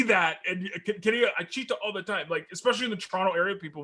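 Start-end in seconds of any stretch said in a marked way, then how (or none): 1.4-2.3 softer, higher mock Jamaican accent; imitated Jamaican accent. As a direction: quote it lightly in a Jamaican accent. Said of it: "he's got this heavy Jamaican accent".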